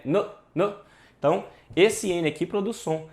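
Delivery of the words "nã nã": "N" said as a short nuh; isolated letter sound. Shown as a demonstration. Teacher defining the sound of N, isolated consonant. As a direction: give "N" as its bare sound, not its name. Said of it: "O som de N é".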